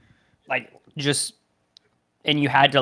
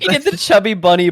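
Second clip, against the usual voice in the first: laughing through the words, louder and higher; 6 semitones higher, 6 dB louder than usual. Real, not acted. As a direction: crack up mid-sentence. Retells joke laughing.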